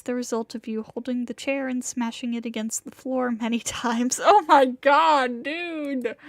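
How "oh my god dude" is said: loud, slow amazement; shocked. Real, not acted. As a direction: gasp it out in disbelief. Reaction to smashed chair.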